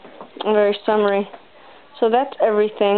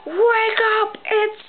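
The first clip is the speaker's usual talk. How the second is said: high, barking drill sergeant wake-up call; mock drill sergeant shouting you out of bed; army drill sergeant; 13 semitones higher than usual. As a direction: yell it like a sergeant rousing recruits at dawn. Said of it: "like an army drill sergeant coming to kick your ass".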